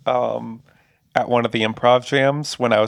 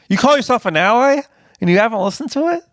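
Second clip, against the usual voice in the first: mock outrage; 9 semitones higher, faster than usual.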